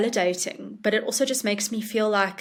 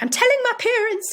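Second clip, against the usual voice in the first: put-on very high, louder kid voice; eager child; 5 dB louder than usual. Author imitating schoolkids promising to tell their parents, high and eager. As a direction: blurt it like an excited little kid. Kids' eager response after reading.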